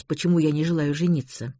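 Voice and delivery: ordinary delivery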